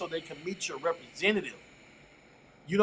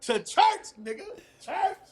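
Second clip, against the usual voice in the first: surprised; 9 semitones higher, slower than usual. Real, not acted. Mock shock at church answer.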